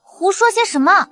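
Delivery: high, flustered protest; indignant rebuke. Acted. Woman rebuking her lover's innuendo, indignant.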